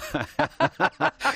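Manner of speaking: high, bursting real laugh; burst of laughter. Real, not acted. Teased on air, he bursts out in high laughter. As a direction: caught off guard, laugh it out.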